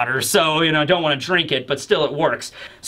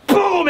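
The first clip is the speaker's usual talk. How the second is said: loud, high impact boom; high mock boom; 11 semitones higher, 3 dB louder than usual. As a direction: punch the word out high. Punch sound effect.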